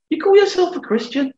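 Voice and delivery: very high, mocking accuser's challenge; preacher mimicking the devil's sneer, high and pointed; scornful devil. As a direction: throw the question back as a taunt. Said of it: "he doesn't speak in the same way".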